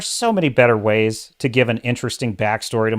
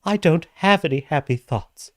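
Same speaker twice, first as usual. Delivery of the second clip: a hint of a sad, softer Hook; mock sad Hook; 3 dB quieter than usual. Reviewer quoting Jude Law's Hook before his fall, lightly mournful. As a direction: sigh out the line mournfully. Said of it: "looks at him and says".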